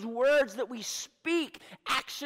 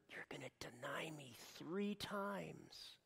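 unvoiced, hushed warning; barely audible whisper; whispered prophecy; 5 semitones lower, 18 dB quieter than usual. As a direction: whisper it like a secret doom.

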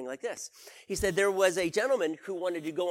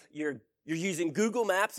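ordinary delivery

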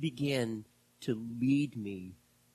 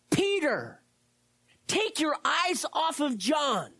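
higher, slow, earnest command; commanding Jesus; 4 dB louder, slower than usual. Pretend. Jesus urging Peter to stop comparing, raised and slow. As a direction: call Peter back firmly.

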